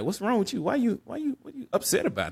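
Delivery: imitating a brother's high-pitched questioning; puzzled questioning. Brother questioning him in retold scene.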